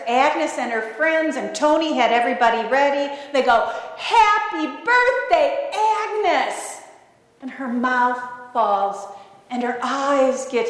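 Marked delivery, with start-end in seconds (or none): 4.0-6.8 high, drawn-out party greeting; slow, jubilant group cheer; surprise cheer. Retold or imitated. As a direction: imitate a crowd yelling surprise. Crowd's surprise birthday greeting, acted.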